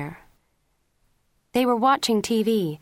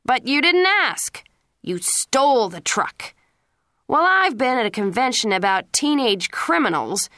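furious tirade; 4 semitones higher, 6 dB louder than usual. A narrator's reading. Police chief father raging at his son over the stolen truck, loud furious tirade. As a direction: build the rage line by line.

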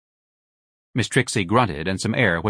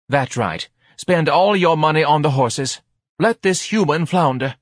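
high, raised, sarcastic retort; bitter wife; 7 semitones higher, 5 dB louder than usual. A narrator's reading. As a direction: throw it back at the husband as the wronged wife. Wife's bitter retort to husband.

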